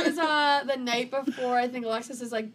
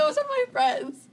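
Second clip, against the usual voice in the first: much higher, slow, pleading; high squeaky retort; 13 semitones higher, 6 dB louder, slower than usual. Retold or imitated. To the guy, hearing her friends at his door, squeaky and slow. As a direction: squeak it out, drawn long. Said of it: "I go".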